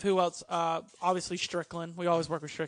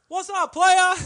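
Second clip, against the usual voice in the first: much higher and louder hammed-up greeting; Teddy Long greeting; 11 semitones higher, 11 dB louder, slower than usual. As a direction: belt out a cool-guy hello.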